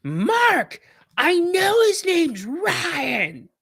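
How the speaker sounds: high, loud, slow shout across a quiet gym; coach's loud retort. Retold or imitated. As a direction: yell it slowly so the whole gym hears. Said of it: "And Coach in a quiet gym goes".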